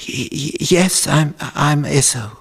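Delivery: stuttering, high-pitched false assurance; nervous liar. Acted. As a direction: stammer out the lie up high. Jacob nervously lies to father.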